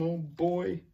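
ordinary delivery

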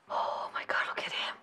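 breath-only, very soft swooning; hushed fangirl awe; awed whisper. Real, not acted. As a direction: whisper it, overcome by the sight. Awed by favourite character.

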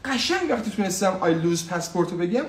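normal talking voice

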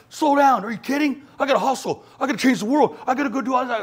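high, loud frantic disbelief; indignant go-getter; incredulous hustler. Retold or imitated. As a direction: protest like slowing down is absurd. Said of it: "And I'm like".

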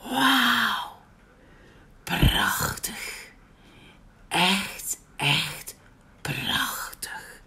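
soft breathy whisper of wonder; hushed, almost tearful awe; awed sigh. Acted. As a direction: breathe it out, overwhelmed by the view. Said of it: "zuchtte hij verrukt".

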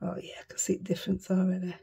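quick whisper to yourself; pleased whisper. Real, not acted. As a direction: whisper it fast, quietly pleased.

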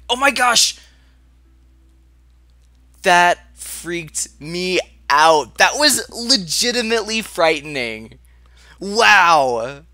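loud high shaken exclamations; scared and shaken. Real, not acted. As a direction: still rattled from the fright. Reacting to a scare.

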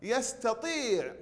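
يستطيع with tii held long; saturated long ii. Shown as a demonstration. Teacher modelling the answer, long ii. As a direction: stretch the vowel after the taa in يستطيع. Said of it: "نشبع حرف الطاء بالكسرة".

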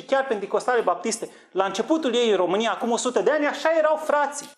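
impassioned sermon peak; fervent preaching. Real, not acted. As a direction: preach it with rising fervour. Preaching climax on old church.

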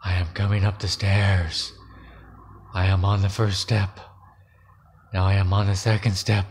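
low, hushed creeping threat; eerie whisper. A narrator's reading. Strange voice warns Ellen it is climbing the stairs, eerie whisper. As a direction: keep it low and measured, like something approaching. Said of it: "it whispered".

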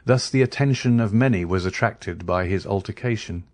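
plain reading voice

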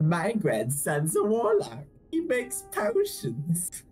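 put-on slow, high old granny; old lady voice. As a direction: brag like a proud granny. Grandma voices bragging.

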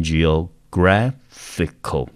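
"graphical" slowly, broken into syllables, GRAPH stressed; stress on third syllable. Shown as a demonstration. Teacher warning students the stress moves, third-syllable stress on "graphical". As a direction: say "G O，graphical" in slow pieces, landing on "graph". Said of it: "重音节跑到第三个音节了".

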